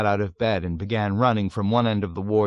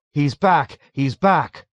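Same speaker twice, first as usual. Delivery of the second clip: higher, louder chanted alarm; hysterical calling; 5 semitones higher, 6 dB louder, slower than usual. A narrator's reading. Men rushing back and forth, calling out hysterically. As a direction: call it out in mild hysteria. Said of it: "calling out hysterically".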